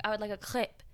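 "crepe" with exaggerated French throaty r and short vowel; imitated customer overdoing French on "crepe"; over-French crepe. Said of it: "pronouncing it in the French way".

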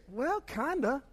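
unsure kinda. Retold or imitated. Church's unsure reply acted out.

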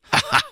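very high acted "ha, ha"; short, high-pitched stage laugh; mocking laugh. Englishman laughing at his challenger, mocking. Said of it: "rief der Engländer".

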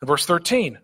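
ordinary talk, nothing marked

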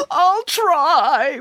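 high, brave tearful promise; weeping Boris. A narrator's reading. Boris promises Nanny Piggins to stay strong, weeping. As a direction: try to be brave through tears. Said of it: "wept Boris".

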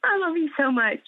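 choked up and laughing through real tears; tearful thanks between laughs; crying. Real, not acted. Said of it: "sorry, I'm crying now".

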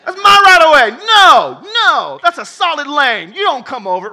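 very loud, high furious driver; hammed-up lane dispute shout; mock road rage shout. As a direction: yell it out like an offended motorist. Acted road rage quarrel.

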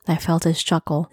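ordinary delivery, nothing marked

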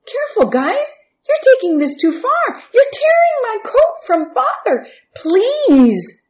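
very high, loud frantic protest; panicked pleading. A narrator's reading. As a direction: beg them to stop, voice shooting up. Joseph pleads as robe is torn.

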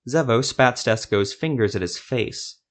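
plain reading voice

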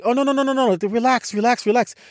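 high-pitched string of soothing repeats; hurried reassurance. Retold or imitated. Soldiers telling traveler to relax, high hurried reassurance. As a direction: imitate someone rushing to put a worrier at ease.

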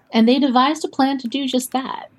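ordinary talk

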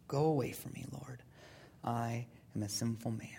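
hushed, near-whispered confession; humbled Simon Peter. Acted. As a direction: let it sink to a very soft, low plea. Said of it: "he fell at Jesus' knees and said".

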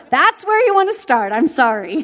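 high, loud, amused remark; laughing aside. Real, not acted. Preacher telling the congregation, high and laughing. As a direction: say it still laughing.

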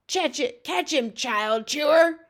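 high giant shout, last words drawn out. Acted. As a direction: shout it, stretching the name at the end. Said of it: "he shouted".

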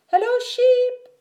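very high, loud greeting; Duck calling out. A narrator's reading. Duck greets Sheep while riding, calling out high. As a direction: sing out hello in a high duck voice.

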